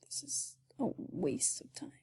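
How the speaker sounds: unvoiced whisper to self; whispered aside. Real, not acted. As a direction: whisper it under your breath. Frustrated aside while drawing.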